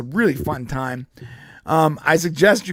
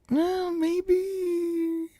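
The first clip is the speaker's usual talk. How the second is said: higher, grudging mock admission; much higher hesitant grudging voice; reluctant concession; 12 semitones higher than usual. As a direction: concede it slowly, half joking. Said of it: "and I'm like".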